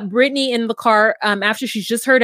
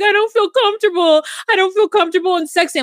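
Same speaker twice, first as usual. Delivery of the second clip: high whiny mock of Brittany; 10 semitones higher than usual. Host mimics Brittany complaining about body, high whine. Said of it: "She's like".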